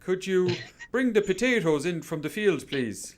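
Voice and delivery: high, loud stab at an Irish accent; American-style Irish accent. Shown as a demonstration. Co-host showing off his attempt at Irish, mock Irish accent. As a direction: put on a hammy American version of Irish. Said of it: "an American version of an Irish accent".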